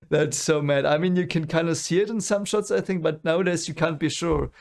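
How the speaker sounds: ordinary delivery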